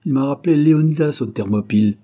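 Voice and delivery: loud, high-pitched scoff; mocking retort; sneering. A narrator's reading. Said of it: "reprit-il en ricanant".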